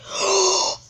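high startled gasp; sharp gasp. Real, not acted. Gamer reacting to the match, sharp gasp. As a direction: suck in a quick, high breath.

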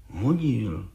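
soft, sulky mumbled admission; stubborn mumble. A narrator's reading. Brickmaker answers the judge, mumbling stubbornly. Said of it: "mumlal Václav Lisický s beraný tvrdohlavostí".

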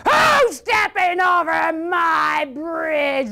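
slow, high-pitched roaring shout; troll's roar. Acted. Troll challenging the goat on his bridge, roaring. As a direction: roar it out, stretching every word. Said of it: "roared the troll".

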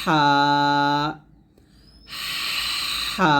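হা pressed from the middle of the throat, thin; throaty Arabic ha. Shown as a demonstration. Teacher showing a pressed throat হা. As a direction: breathe হা out from mid-throat with pressure. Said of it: "হলকের মাঝখান থেকে চাপ দিয়ে চিকন করে".

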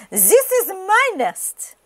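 high, slow duck voice; indignant duck. A narrator's reading. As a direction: stake a claim, high and drawn out. Duck claims the nest.

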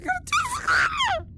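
high battle cry for Grandma; rallying shout. Acted.

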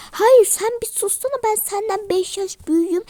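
high, loud scolding of the mother; a kid playing the older sister, shouting back in anger; angry sister shout. Kids' family role-play quarrel.

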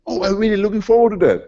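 bright, fast mock eagerness; sarcastic delight. To colleagues who warn him, quick mock-eager reply. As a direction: gleefully welcome becoming obsolete. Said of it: "I say".

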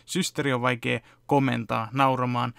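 ordinary delivery

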